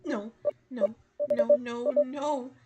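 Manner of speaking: rising panic; panicked. Acted. Realizes attacker found her.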